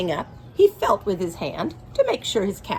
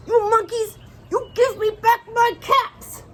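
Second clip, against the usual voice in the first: much higher, louder stomping fury; very angry demand; 13 semitones higher, 4 dB louder than usual. Acted. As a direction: stamp your foot and yell it up at the tree. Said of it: "this made the peddler so angry".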